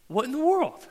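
high, indignant "what in the world"; high, put-out outburst; exasperated. Retold or imitated. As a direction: act out finding the pillow behind the door. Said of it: "and I go".

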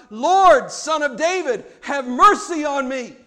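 acted shout, bursting on the first word; crying out. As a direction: cry out like a beggar calling for mercy. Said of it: "Bartimaeus cried out".

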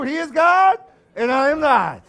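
high, loud, mock-triumphant; said with a smile, loud. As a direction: gloat it loudly past the pulled-over driver. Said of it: "say that one with a smile".

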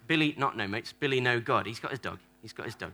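usual speaking voice

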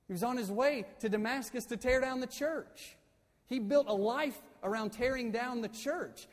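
full-force preaching. Acted. Pastor hammering home Paul's past to his church, full-force preaching. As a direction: preach it out at the top of your voice.

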